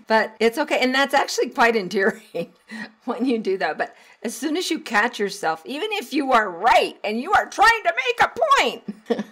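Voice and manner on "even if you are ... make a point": high, loud mock insistence; hammed-up yelling to win an argument; demonstrated yelling. Shown as a demonstration. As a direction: climb into a yell to hammer the point. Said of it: "not making any points when you're yelling".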